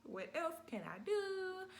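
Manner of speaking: high, slow pained whimper; reliving a labour pain, high and drawn out; pained whine. Retold or imitated. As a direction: plead through the pain, stretched out. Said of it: "I'm like".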